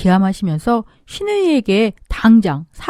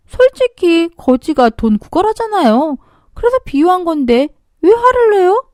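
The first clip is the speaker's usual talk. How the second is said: snotty retort; 7 semitones higher than usual. Acted. Sister-in-law retorts, sneering.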